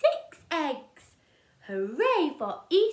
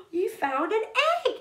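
ordinary delivery, nothing marked

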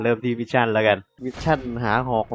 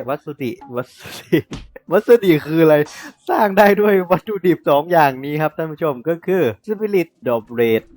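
laughing; 5 semitones higher, 6 dB louder than usual. Real, not acted. สับสนคำแล้วหัวเราะ.

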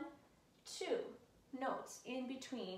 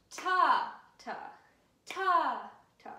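loud accent on every other ta; 4 semitones higher, 10 dB louder, slower than usual. Shown as a demonstration. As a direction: punch the accented tas loud. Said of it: "say them a little bit louder or emphasize them".

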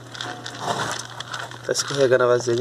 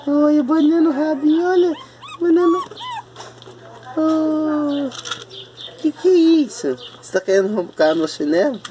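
high, sing-song cooing at the dogs; sweet high pet voice; 10 semitones higher than usual. Real, not acted.

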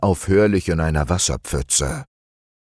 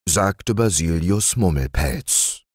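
plain reading voice

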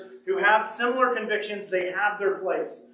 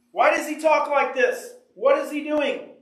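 raised, higher incredulous questions; mock outrage, higher; mock indignant Pharisee; 6 semitones higher, 3 dB louder than usual. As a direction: play the offended Pharisee, pitched up.

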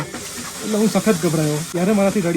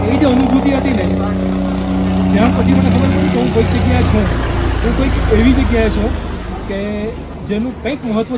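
raised, louder voice full of awe; moved excitement; 4 semitones higher, 6 dB louder than usual. Retold or imitated. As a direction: lift the voice with remembered wonder. Recalling the moment before Kedar Baba. (ઊંચા, જોરદાર અવાજે ભાવવિભોર)

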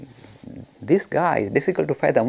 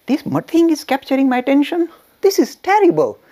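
rising to a higher, louder indignant cry; disgusted outburst; 13 semitones higher, 5 dB louder than usual. Retold or imitated. Ramakrishna rejecting the expensive shawl, disgusted and rising. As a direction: blurt it out in mounting disgust. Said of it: "he became very disgusted".

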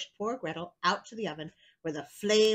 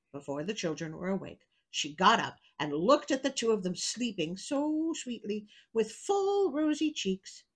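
ordinary delivery, nothing marked

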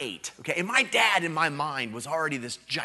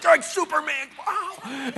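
put-on much higher, loud childlike shout of wonder; excited kid shout; 7 dB louder than usual. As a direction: yell it like an amazed eight-year-old. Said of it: "You know, it's like".

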